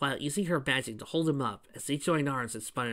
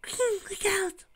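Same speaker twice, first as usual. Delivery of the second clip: much higher, slow squeaky call; squeaked warning; slower than usual. Acted. Fluttershy warning Cream, high and squeaky. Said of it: "Fluttershy squeaked".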